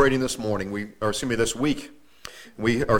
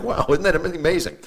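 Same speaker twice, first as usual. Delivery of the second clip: mock amazement, higher; sarcastic; 4 semitones higher than usual. Preacher recalls his sarcastic reaction at the funeral. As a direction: drip with sarcasm.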